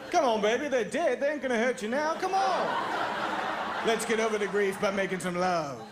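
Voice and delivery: put-on high, coaxing come on baby with a laugh; a hammed-up lover-boy voice pleading with the girlfriend; smooth-talker voice again. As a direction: woo her back like a cheesy lover. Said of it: "I don't talk like that".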